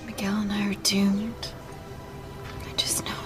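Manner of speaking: hushed frightened whisper. Acted. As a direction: breathe it out in fear. Fearful secret aside to friend.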